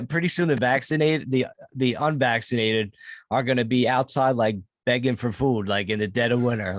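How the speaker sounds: usual speaking voice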